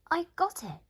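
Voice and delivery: high, loud burst of discovery; excited cry. Acted. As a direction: shout it as the idea hits. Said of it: "she cried".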